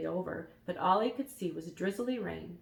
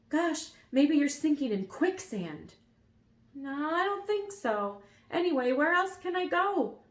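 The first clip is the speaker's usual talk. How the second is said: higher excited voice; character voice; 9 semitones higher, 6 dB louder than usual. A narrator's reading. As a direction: voice it higher and louder. Dialogue voiced.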